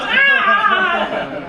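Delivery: high, loud imitated kid yell; mock gleeful scream; child's yell. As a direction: yell like a small boy running off. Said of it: "He goes".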